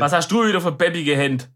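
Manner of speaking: Swabian parent's mild scolding. Shown as a demonstration. Mock-scolding a child over sticky hands, Swabian. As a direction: scold lightly in Swabian dialect. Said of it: "wie man im Schwabenland zu klebrigen Händen sagt".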